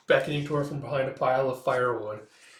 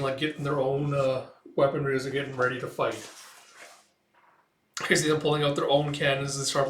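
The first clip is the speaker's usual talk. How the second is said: plain reading voice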